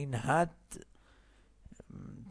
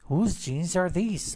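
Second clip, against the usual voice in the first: slow and higher, questioning rise; teacher modelling; 6 semitones higher, 4 dB louder, much slower than usual. Shown as a demonstration. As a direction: model the title slowly for students. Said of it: "لطفاً تکرار کنید".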